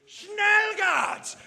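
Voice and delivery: panicking mock German accent, pitched high; Angela Merkel. Chancellor panicking and calling guards, mock German accent. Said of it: "She'll be panicking, speeding up".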